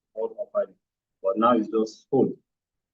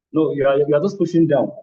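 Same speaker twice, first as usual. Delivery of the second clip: louder, higher, firm correction; 5 semitones higher, 9 dB louder than usual. Real, not acted. Physio correcting patient's pushing, loud firm correction. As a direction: stop them and set them straight.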